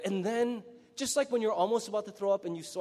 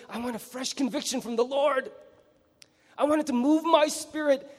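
eager churchgoer; 6 semitones higher than usual. Retold or imitated. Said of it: "we're always like".

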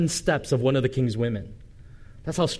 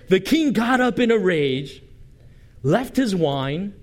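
dramatic rage; 5 semitones higher than usual. A narrator's reading. Pastor rereads the verse to the congregation with mock fury. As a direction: read it like the king erupting, then slow on wine. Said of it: "but it says".

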